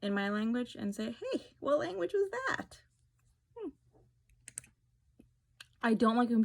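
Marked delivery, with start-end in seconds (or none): 1.2-3.7 high, put-on prying voice; curious stranger. Stranger overhearing her call to her mother, high and curious. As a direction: chirp it like a nosy stranger.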